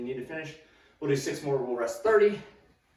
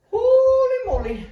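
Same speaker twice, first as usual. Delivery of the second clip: high, loud, slow holy moly; exclaiming; 11 dB louder than usual. Real, not acted. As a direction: gasp it out, amazed and spent. After the hard set.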